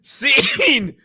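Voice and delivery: high, loud shout to end the bit; shouted cut. Real, not acted. Drew ends the impression bit, high shout. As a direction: call it out like ending a sketch.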